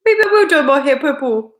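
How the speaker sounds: loud, high mock Scottish accent; exaggerated amateur Scottish accent; attempted Scottish accent. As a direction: belt it out in a put-on Scottish voice. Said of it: "how could I do a Scottish accent".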